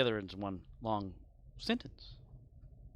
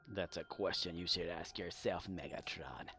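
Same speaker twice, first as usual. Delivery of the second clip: lower, softer mock-solemn retort; mock grave; 6 semitones lower, 6 dB quieter than usual. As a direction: answer like a wise elder, teasing. Mock grave reply to game.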